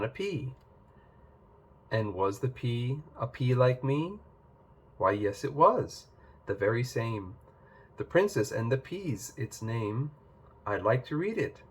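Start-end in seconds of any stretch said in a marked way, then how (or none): none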